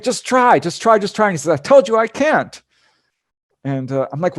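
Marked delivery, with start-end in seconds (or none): 0.0-1.3 high, loud pleading yell; repeated yell of just try; retold shouting. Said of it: "a little bit of a shouting match". 1.6-2.6 high, loud exasperated retort; loud I told you I can't; brother shouting back. Retold or imitated. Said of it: "a little bit of a shouting match".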